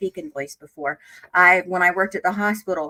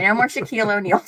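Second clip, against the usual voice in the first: ordinary delivery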